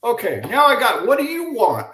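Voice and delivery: loud, high-pitched mock exasperation; overwhelmed. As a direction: act out being flustered and at wit's end. Retold panic facing a star teammate.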